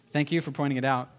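usual speaking voice

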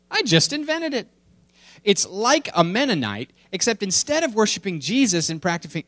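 high-pitched cartoon child voice; little daughter. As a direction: put on an eager little kid asking Daddy. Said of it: "I'll read the cartoon to you".

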